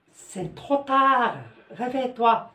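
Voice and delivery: mock parent rousing a late sleeper; waking someone up. Teacher playing a parent waking a child who is late, lightly called out. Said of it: "تقول له الوقت متأخر".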